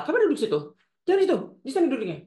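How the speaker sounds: high, loud bossy correction; critical mother. Acted. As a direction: act out a nagging mother ordering seats. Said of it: "Kita duduk salah". (ibu mengomel dengan nada tinggi)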